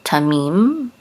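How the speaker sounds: "tamim" as ta-MIM, the end drawn out; last-syllable stress. Shown as a demonstration. Teacher shows the accent, "tamim" stressed on MIM. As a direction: lean on the final syllable of "tamim". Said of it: "Accent is on the last syllable".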